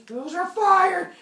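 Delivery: loud, high, drawn-out shout; alarmed realization. Acted. Zeus spots fires on earth, shocked shout. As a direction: burst out in shock.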